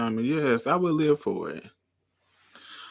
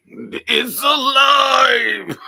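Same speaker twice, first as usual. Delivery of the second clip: slow, much higher, loud shout; mad scientist; 13 semitones higher, 9 dB louder, much slower than usual. Retold or imitated. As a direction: cry out like Frankenstein's doctor. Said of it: "They used to give out honey".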